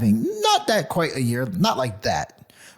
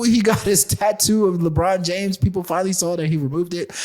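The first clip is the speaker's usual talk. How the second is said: normal talking voice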